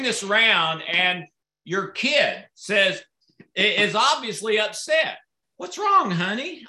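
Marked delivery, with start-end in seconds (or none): 5.6-6.6 concerned parent. Retold or imitated. Parent asks upset kid.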